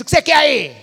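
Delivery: curt, rude wife talking back. Retold or imitated. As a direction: snap it out like an irritated wife. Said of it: "a esposa vai falar com Cristo". (esposa respondendo com grosseria)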